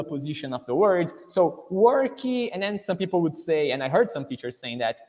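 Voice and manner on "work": "worky" said slowly and high, with an added ee at the end; learner's mispronunciation. Shown as a demonstration. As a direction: drag out "worky" with the extra final vowel. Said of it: "beautiful I that appears in the end".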